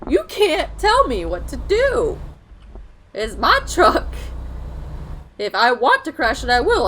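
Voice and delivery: loud, high, defiant outburst; hammed-up loud refusal; mock defiance. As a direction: shout down the game like a bratty kid. Defies the game's warning.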